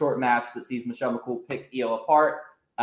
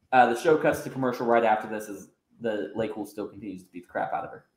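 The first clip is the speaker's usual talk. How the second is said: usual speaking voice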